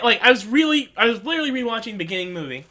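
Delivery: ordinary delivery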